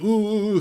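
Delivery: high, loud mocking oohs; mock indignant ooh. Mocking the huffy pedestrian, hammed-up oohs. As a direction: parody a huffy man. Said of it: "still trying to give attitude".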